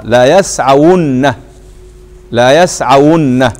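يسعون slowly as "yasʕawunna"; slow, deliberate لا يسعون, leaning on the final nun; voweled waw, doubled nun. Shown as a demonstration. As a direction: sound out يسعون with "wu" and a held "nn". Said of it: "فتبقى محركة".

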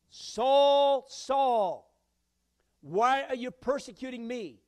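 loud, high, ringing call of Saul; heavenly call. Acted. God calling out to Saul on the road, booming. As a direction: call down from heaven to wake him.

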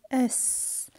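soft voiceless hiss on "S"; isolated s sound. Shown as a demonstration. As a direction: hold "S" as a bare sss. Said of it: "I pronounce the S".

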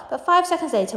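usual speaking voice

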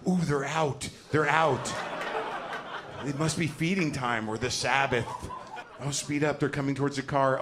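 high, loud thrill of spotting animals; hammed-up alarm and excitement; safari-park excitement. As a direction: gawk aloud like a wildlife-park visitor. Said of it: "with, like, the same energy".

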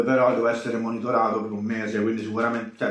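talking through hand over mouth; speaking while finishing bite; mouth full chewing. Real, not acted. Seen 2: Hand covers mouth mid-bite while speaking; visible from 1.0s on.

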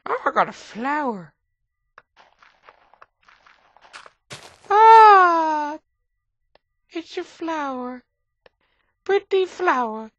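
high, delighted squeal over a flower; squealing with glee at a pretty flower; excited squeal. Acted. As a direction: gush like a child with a new toy.

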